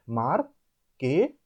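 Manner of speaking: "marketing" slow, with gaps between mar, ke and ting; jaw-drop syllable demo. Shown as a demonstration. Teacher repeating the method for learners, "marketing" in slow separate beats. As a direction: draw out each syllable of "marketing". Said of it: "Use the jaw dropping method".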